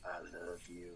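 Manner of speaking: low, hushed mock of Seagal's put-on urban accent. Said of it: "he's like".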